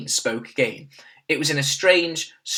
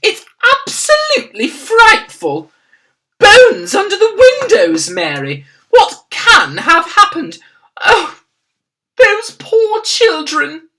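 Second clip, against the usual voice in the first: high, loud, distraught royal voice; the Queen in horror to her maid; distressed cry; 13 dB louder than usual. A narrator's reading. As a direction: cry out over the lost children. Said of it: "the famous voice cried out".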